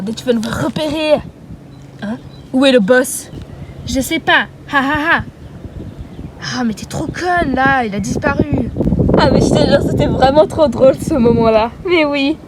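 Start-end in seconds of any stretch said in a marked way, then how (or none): none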